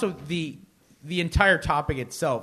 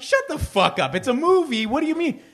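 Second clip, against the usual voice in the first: mock disbelief climbing much higher; incredulous scoff; 11 semitones higher than usual. Scoffing at a friend who won't see the movie, rising mock disbelief. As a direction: scoff at a friend, pitch rising with each phrase. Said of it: "And I was like".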